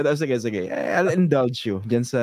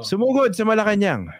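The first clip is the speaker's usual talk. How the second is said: mild mock shout; 6 semitones higher than usual. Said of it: "Sumigaw eh".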